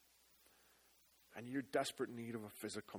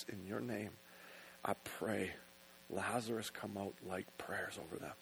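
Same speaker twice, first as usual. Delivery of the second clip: prayer; 3 semitones lower, 4 dB louder than usual. Acted. Closing prayer over music.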